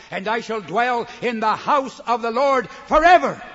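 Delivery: loud preaching climax on forever; rising to a shout. Acted. Preacher proclaiming the psalm to the congregation, loud.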